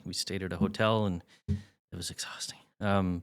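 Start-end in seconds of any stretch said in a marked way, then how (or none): 1.5-2.6 worn-out whisper, much softer. Real, not acted. Dad recalling three draining test days, tired whisper. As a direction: let the breath carry it, drained.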